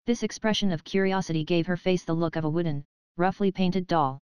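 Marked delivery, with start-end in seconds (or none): none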